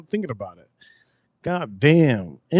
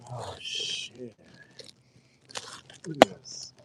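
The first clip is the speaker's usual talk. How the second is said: whispered oh shit; 3 semitones lower, 14 dB quieter, slower than usual. Real, not acted. Surprised whisper to self.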